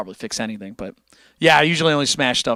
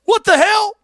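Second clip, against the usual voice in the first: surprised outburst; 5 dB louder than usual. Real, not acted. Laughing reaction outburst.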